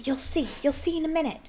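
high-pitched eagerness without extra volume; excited. A narrator's reading. Hermione leading friends to her surprise, excited. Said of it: "said Hermione excitedly".

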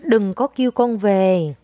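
put-on very slow, word by word, for a child to repeat; slow model phrase. Shown as a demonstration. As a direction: stretch each word out as a lesson. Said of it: "con nói". (mẹ nói thật chậm cho con lặp lại)

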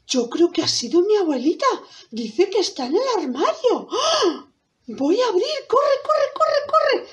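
put-on high, excited girl voice. Girl hearing grandmother in the wardrobe, high excited voice. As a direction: build from hopeful to a high, hurried squeal.

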